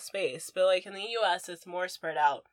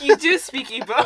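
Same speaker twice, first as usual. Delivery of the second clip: loud, high, laughing retort; insistent contradiction; 7 semitones higher, 12 dB louder than usual. Retold or imitated. Guest retelling her argument with little cousin, laughing insistence. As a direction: contradict him loudly through laughter.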